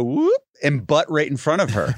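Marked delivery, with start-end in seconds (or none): 0.0-0.4 playful whoop. Retold or imitated. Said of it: "I just go".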